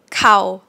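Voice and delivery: "Cow" cut short and loud; short vowel. Shown as a demonstration. Teacher shortens the vowel in the comparison drill. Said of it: "now shorten it".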